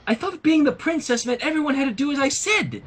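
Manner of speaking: a hint of a spoiled Trixie. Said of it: "said Trixie".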